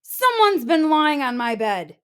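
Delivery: father bear protesting about his bed; indignant Papa Bear. Acted. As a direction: complain with force.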